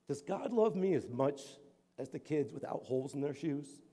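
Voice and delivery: put-on small boy's hushed, halting question; quiet, vulnerable child asking; soft earnest question. Poor boy's heartfelt question, voiced softly.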